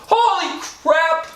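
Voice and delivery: high drawn-out yelp of shock; shocked cry. Real, not acted. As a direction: cry out slowly as the ice hits. Ice touches skin.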